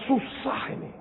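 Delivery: God whispering his frustration; whispered complaint. Pretend. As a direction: mutter it to yourself in a whisper. God sighing over weak person.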